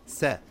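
"sa" with a thin, fronted a; corrected light sa. Shown as a demonstration. Teacher repeats the correct syllable, light vowel. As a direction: lighten "sa" toward "seh". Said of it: "not sa".

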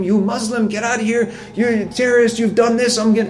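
mock the angry driver's rant in a high voice; retold yelling. Preacher retelling a furious driver's insults, high mock yell. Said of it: "She was yelling and swearing".

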